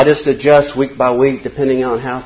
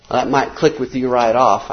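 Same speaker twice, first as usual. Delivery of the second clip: ordinary delivery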